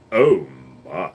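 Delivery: low, drawn-out catchphrase impression; George Takei. Streamer asked for his best rainbow, low celebrity impression. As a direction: drop the voice deep and stretch it out. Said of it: "George Takei was very rainbow".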